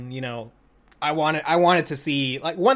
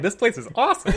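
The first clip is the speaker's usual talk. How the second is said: delighted; 7 semitones higher than usual. Retold or imitated. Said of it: "And I'm like".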